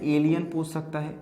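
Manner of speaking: ordinary talk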